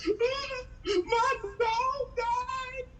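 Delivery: very high, drawn-out comic wail; mock sobbing child. Comic imitating a little girl whose dog died, wailing. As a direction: bawl it slowly like a heartbroken little girl. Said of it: "this little girl, uh, she started crying".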